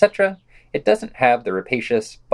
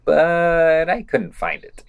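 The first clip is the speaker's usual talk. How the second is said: slow, higher, drawn-out sarcasm; sarcastic slow letdown; deadpan punchline; 6 semitones higher, much slower than usual. Real, not acted. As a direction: stretch it out dryly. Dry punchline of review.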